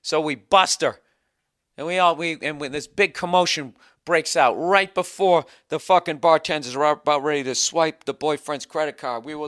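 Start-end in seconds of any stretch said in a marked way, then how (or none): none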